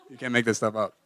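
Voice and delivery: ordinary talk, nothing marked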